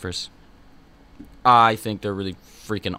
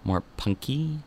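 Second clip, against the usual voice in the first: ordinary talk, nothing marked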